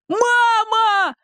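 high long cry; boy yelling. A narrator's reading. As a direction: Petka crying out to mother. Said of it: "завопил Петька". (громкий детский вопль)

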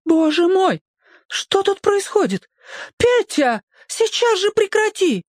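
high alarmed woman's voice; mother scared for her son; frightened mother. A narrator's reading. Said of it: "совершенно перепуганный". (испуганный высокий женский голос)

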